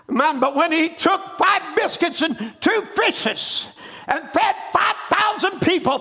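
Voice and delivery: rising preacher's cry, building; preaching. Acted. Preacher exhorting the congregation, rising fervent cry. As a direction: proclaim it to the congregation.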